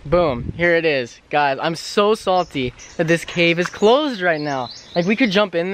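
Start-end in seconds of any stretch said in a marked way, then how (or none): none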